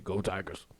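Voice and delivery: put-on very low, quiet deep-swamp accent; Ed Orgeron's accent. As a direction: answer as a swamp-country coach, low and under the breath. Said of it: "Ed's actual accent".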